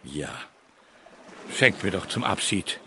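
usual speaking voice

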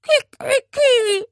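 high loud crow with a wailing sadness; plaintive crow. Acted. Parrot wailing from under the blanket, plaintive crow. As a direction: crow like a sulking bird. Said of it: "ertönte es klagend".

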